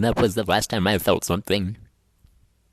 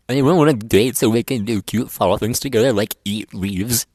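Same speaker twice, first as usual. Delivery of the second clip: usual speaking voice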